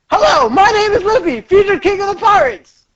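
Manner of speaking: high, bright cartoon hero voice; lightly voiced eager young pirate; Luffy voice. Game character voices Luffy.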